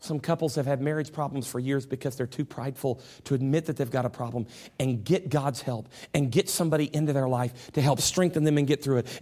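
ordinary talk

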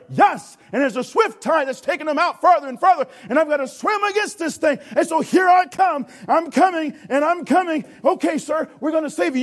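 excited rescue. Acted. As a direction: call out against the current. Lifeguard fights the tide.

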